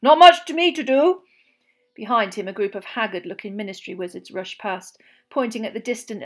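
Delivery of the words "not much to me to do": loud, high, breathless bluster; puffing, excited Bagman. Acted. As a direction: bounce in out of breath and thrilled. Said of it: "he puffed".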